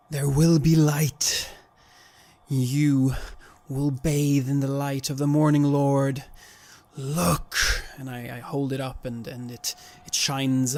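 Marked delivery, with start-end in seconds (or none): 0.1-7.6 commanding yell. Acted. Cleric to the young man, commanding yell. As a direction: call it out like a cleric wielding holy light. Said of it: "I yell to the young man".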